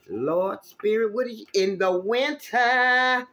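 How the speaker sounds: high, loud and drawn out, pleading with the spirit; slow, lifted cry to the heavens; excited plea. Real, not acted. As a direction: call up to the spirit, voice climbing. Calling out to the spirit.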